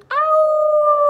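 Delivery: big, high, loud wolf howl. Shown as a demonstration. Teacher showing kids what the wolf says, loud howl. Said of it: "says the wolf".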